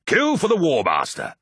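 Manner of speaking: loud, high war shout; rallying battle cry. Acted. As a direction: cry the oath out to your brothers. Warriors' rallying oath, hands joined.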